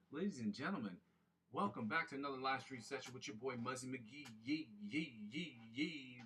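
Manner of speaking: big hype-man welcome; announcer intro. Acted. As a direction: announce it like a show host. Opens stream like a host.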